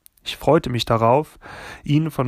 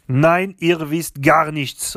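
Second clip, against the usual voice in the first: put-on higher, louder French-accented rebuke; indignant cry; 5 semitones higher, 5 dB louder, slower than usual. A narrator's reading. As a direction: cut in sharply as an angry grandmother. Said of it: "rief Grandmère". (empörter Ausruf der Großmutter)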